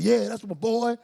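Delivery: loud, high cheer from dad; imitated proud dad yelling. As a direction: mimic a father hollering from the sideline. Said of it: "He yelling at the scrimmage".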